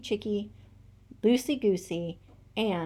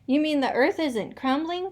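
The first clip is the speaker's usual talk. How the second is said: cried out; 8 semitones higher, 4 dB louder than usual. A narrator's reading. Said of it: "cried out Nanny".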